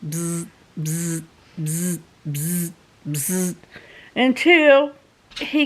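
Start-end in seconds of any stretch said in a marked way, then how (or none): 0.0-3.5 put-on low soft buzzing like an old alarm clock; buzzing alarm imitation. Telling her viewers about the old alarm, low soft buzzing. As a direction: make the buzz of an alarm clock. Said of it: "it would make like a".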